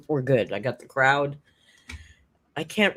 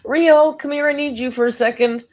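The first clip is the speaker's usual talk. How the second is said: calling the cat; 11 semitones higher, 9 dB louder than usual. Real, not acted. Calling her cat during stream.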